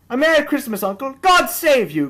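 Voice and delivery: high, loud, bright greeting; cheerful cry. A narrator's reading. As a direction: call out a merry hello to your uncle. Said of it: "cried a cheerful voice".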